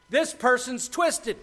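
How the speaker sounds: slow, high, loud accusation; shouting out. Acted. Wisdom accusing the brazen woman, shouting out. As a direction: call out the rival slowly. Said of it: "I'm shouting out".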